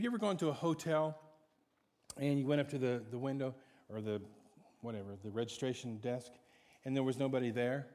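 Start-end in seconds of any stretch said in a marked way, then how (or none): none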